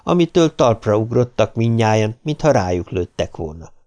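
ordinary delivery, nothing marked

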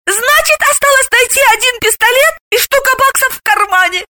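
very high, gleeful exclamation; delighted high-pitched squeal of words; overjoyed. A narrator's reading. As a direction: rejoice at easy money. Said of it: "а Галина обрадовалась".

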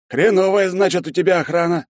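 high, loud scolding; reproachful. A narrator's reading. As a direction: scold him for the guards. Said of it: "с упрёком произнёс Щедрин".

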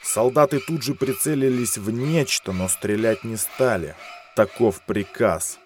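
plain reading voice

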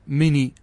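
"مني" repeated as mi-nii, short n; single light nun. Shown as a demonstration. Teacher checking the class follows, "مني" with a single n. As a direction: say "مني" with an undoubled n. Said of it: "وإذا نزعت النون تأتي بالياء فقط".

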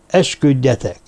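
usual speaking voice

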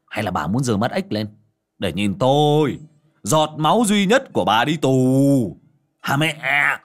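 loud, rising furious shout; furious outburst. Acted. Enraged son shouting at his mother, furious shout. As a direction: bellow the threat at her. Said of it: "Gã điên máu mà rít lên".

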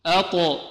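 each letter bounced with an echoing release; qalqalah drill. Shown as a demonstration. Teacher drills qalqalah letters for class, bouncing release. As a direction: pop the stopped letter with a clear rebound. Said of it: "نكررها مرة أخرى".

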